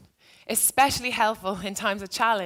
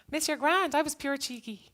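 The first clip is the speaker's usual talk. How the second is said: lightly voiced student, higher pitch; quick reassurance to a teacher, pitched up; retold student reply; 6 semitones higher than usual. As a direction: imitate a pupil letting teacher off.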